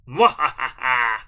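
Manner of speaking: villainous cackle; mad scientist laugh. Real, not acted. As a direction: cackle with evil delight.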